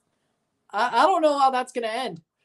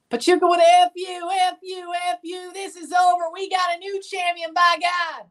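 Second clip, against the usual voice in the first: high, fast goal celebration; excited celebration; 8 semitones higher than usual. Real, not acted. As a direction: cheer the goal like a fan going wild.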